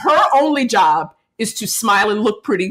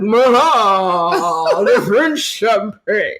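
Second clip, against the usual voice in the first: slow, drawn-out boozy slogan; hammed-up drunken pitchman, stretching the words; drunk Orson Welles; much slower than usual. Retelling take three of the ad, drunk. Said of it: "And he's like".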